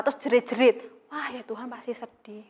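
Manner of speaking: ordinary delivery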